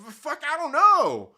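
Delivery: high, loud, slow mock bewilderment; a hammed-up baffled answer to coworkers; stunned reply. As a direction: stammer it out like you're still in shock. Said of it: "I'm like".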